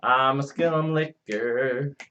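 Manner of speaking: slow, stretched-out echo; mock drawl. Player echoes friend's confession, slow mock drawl. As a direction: drag each word out mockingly.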